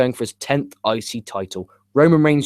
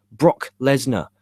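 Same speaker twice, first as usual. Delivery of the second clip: ordinary talk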